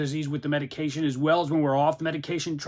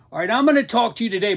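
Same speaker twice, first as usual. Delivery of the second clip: rushed, winded start, a bit higher and louder; out of breath; 7 semitones higher, 5 dB louder, faster than usual. Real, not acted. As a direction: push through while short of breath. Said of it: "Boy, I'm out of breath".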